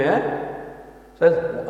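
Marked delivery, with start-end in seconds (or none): 0.0-0.2 puzzled, rising "eh?"; uncomprehending little boy. Retold or imitated. Little Scottish boy answering the visitor, puzzled rising query. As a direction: imitate a boy who didn't catch it. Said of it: "He goes to me".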